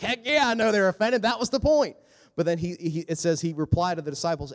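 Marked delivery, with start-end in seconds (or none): 0.0-2.0 high, loud, sassy Jesus voice. Retold or imitated. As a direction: play Jesus, cheeky and unbothered. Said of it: "And Jesus is like".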